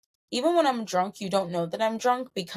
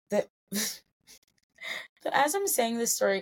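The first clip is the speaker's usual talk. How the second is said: laughing; 4 semitones higher, slower than usual. Real, not acted. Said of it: "I literally am just cracking up".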